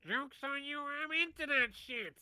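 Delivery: high, loud cartoon villain voice; Skeletor. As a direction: crow it high, dragging out the end. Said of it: "Fuck off, Skeletor".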